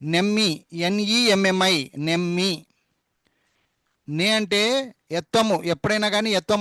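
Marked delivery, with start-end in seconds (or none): 0.7-1.9 ఎన్ఈఎంఎంఐ spelled out very slowly, letter by letter; slow spelling. Shown as a demonstration. Teacher slowly spelling నెమ్మి for the class. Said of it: "ఈ ఒత్తుకి ఎమ్ మధ్యలో".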